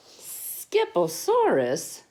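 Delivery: loud, drawn-out declaration; slow, incredulous motherly outburst; declared. A narrator's reading. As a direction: declare it slowly and loudly, as a questioning mama. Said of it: "declared Mama Junebug Jones".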